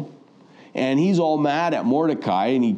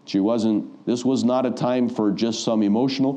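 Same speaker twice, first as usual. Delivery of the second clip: normal talking voice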